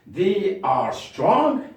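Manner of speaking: They are strong, drawn out slowly; slow model read; slow dictation. Shown as a demonstration. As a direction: say it slowly for pupils to copy. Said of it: "modelling sentence".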